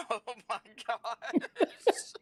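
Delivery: high, loud, slow gasp through laughing; real laughing fit, words dragged out; helpless laughter. Real, not acted. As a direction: laugh so hard the words barely come. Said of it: "I'm speechless".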